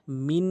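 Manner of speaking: "মিন" with a long hummed n; min with ghunnah. Shown as a demonstration. Teacher shows noon after noon, "মিন" with held n. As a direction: stretch the final N of "মিন" through the nose. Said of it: "মিন উচ্চারণ করব".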